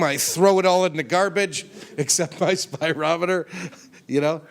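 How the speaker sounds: laughing while telling it. Real, not acted. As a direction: chuckle through the words.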